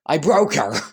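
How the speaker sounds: half-laughing panic, broke pushed out; alarmed exclamation. Real, not acted. Narrator reacts to repeated name.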